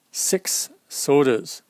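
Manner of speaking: six sodas drawn out slowly; slow repeat. Shown as a demonstration. Reader stressing the words for a child, slowly. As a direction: linger on each word. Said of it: "repeats book phrase slowly".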